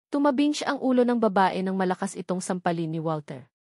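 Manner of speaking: ordinary delivery, nothing marked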